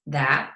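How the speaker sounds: third, contrasting vowel shading on THAT; vowel variant demo. Shown as a demonstration. Showing a listener a third way THAT can sound, vowel demo. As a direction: say THAT slowly with a changed, deliberate vowel. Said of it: "that one sound can sound like, like, three different ways".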